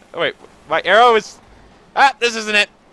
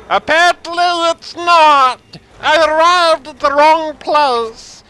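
mock announcer; 4 semitones higher, 4 dB louder, much slower than usual. Announcing his arrival to the crowd, slow pompous announcer voice. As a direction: announce your own arrival like a ring announcer. Same announcer voice, wrong arena.

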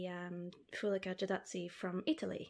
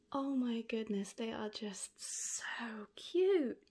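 slow whispered awe; hushed and slow, overcome; awed whisper; slower than usual. Real, not acted. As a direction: breathe it out in wonder. Moved by the cute snails.

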